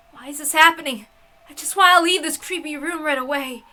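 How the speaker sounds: desperate to escape the locked room; panicked plea. Acted. Stuck at chain lock, panicking.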